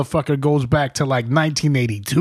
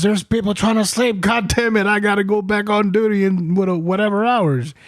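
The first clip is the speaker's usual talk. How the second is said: high, irritated rant; angry scolding; 8 semitones higher than usual. Retold or imitated. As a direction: complain like a tired sergeant. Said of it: "He goes".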